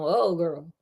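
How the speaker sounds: ordinary delivery, nothing marked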